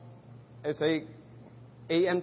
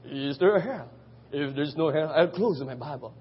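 a lightly voiced imitation of a famous preacher, slightly lower; a hint of the old evangelist, low and sure; Doctor Jack Haas impression; 4 semitones lower than usual. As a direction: mimic a beloved preacher's catchphrase, lightly, not overdone. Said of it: "I always imitate him".

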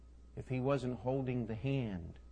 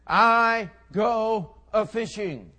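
slow, loud, drawn-out words; emphatic declaration; 7 semitones higher, 11 dB louder, much slower than usual. Acted. Simon Peter announces to the disciples, slow and emphatic. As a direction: declaim each word on its own, loud and high.

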